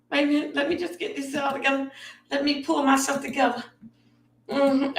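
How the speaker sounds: usual speaking voice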